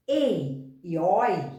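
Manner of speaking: ei e oi drawn out one sound at a time; slow, spelled out. Shown as a demonstration. Teacher slowly names the diphthongs ei and oi, drawn out. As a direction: lay out ei e oi very slowly for the class. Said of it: "Os ditongos abertos".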